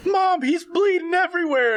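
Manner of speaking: high, loud panicked cry; panicked child voice. Acted. Son calling his mom about the doll, high panic. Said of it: "he, like".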